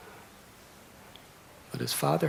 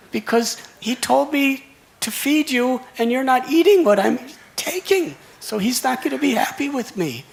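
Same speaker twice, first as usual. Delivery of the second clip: put-on weeping child; 11 semitones higher, 7 dB louder than usual. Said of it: "The little boys on the altar weeping, crying".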